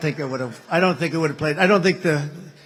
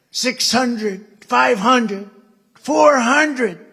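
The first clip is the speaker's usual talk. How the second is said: higher, louder, slow spaced altitude callouts; mechanical voice; 8 semitones higher, 10 dB louder, much slower than usual. Retold or imitated. Cockpit computer calling altitude, imitated mechanical countdown.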